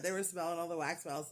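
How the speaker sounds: normal talking voice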